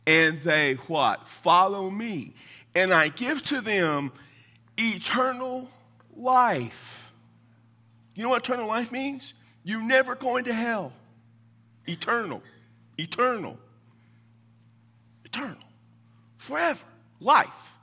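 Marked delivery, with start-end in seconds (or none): 0.1-7.1 each word drawn out slowly for weight; slow, weighty scripture reading; slow emphatic preaching. Acted. 11.9-17.7 rising emphatic repeats; building emphasis. Acted.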